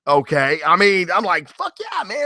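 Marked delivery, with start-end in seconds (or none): 1.5-2.2 high, quiet mock cheer of excitement; mock hyped cheer. Fan mocking the hype, high hyped cheer. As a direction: toss it off as a hyped-up fanboy.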